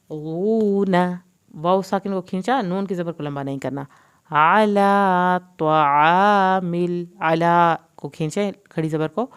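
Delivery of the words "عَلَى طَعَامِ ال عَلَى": slow, drawn-out melodic tajweed recitation; Quran recitation. Shown as a demonstration. Teacher reciting the verse for students, slow melodic recitation. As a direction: chant the verse slowly, stretching every long vowel.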